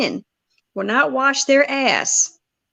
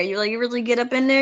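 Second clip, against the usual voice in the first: ordinary talk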